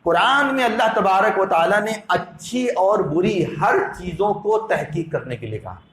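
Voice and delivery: usual speaking voice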